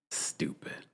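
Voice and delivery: breathed stupid, no voice; a disgusted aside, whispered. Real, not acted. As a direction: whisper it under your breath. Muttered verdict on the cast.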